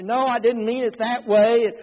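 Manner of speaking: mock-indignant comeback, high and loud; heated written rebuttal. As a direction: argue back loudly, acting out the quarrel. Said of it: "he'd write back and say".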